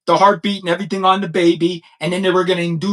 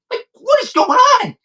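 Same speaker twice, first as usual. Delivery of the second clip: exasperated outcry. Real, not acted.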